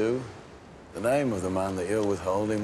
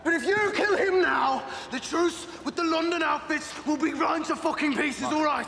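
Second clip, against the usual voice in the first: angry shout. Acted. Tommy yells at Alfie in a heated row, furious. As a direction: shout him down.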